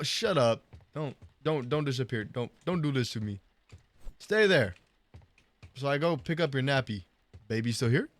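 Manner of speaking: ordinary delivery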